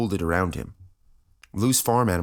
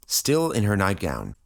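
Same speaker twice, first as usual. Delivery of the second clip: ordinary delivery, nothing marked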